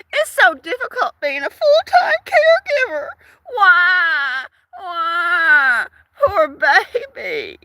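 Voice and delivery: high fake-sobbing whine; mock him as a wailing baby; mocking whiny crybaby. Mocking a man who whines online, high fake sob. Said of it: "he's always whining about".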